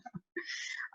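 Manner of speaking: short, quiet, airy laugh; soft chuckle. Real, not acted. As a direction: chuckle softly after a small glitch. Relieved reconnection after dropped sound.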